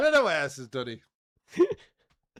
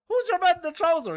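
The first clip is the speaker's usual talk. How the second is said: puzzled repeat; 13 semitones higher, 3 dB louder than usual. Retold or imitated. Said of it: "And I was like, "What?".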